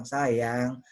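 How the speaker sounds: low, drawn-out sweet talk; tender, lingering pet names; affectionate endearments. Shown as a demonstration. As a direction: coo lovingly to a partner, slowly. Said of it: "sayang sama orang dengan cara yang kasar".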